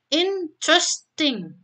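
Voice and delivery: teacher stretching Interesting for the class; slow model word. Shown as a demonstration. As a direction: slowly model Interesting in three syllables. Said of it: "Ada tiga silabel".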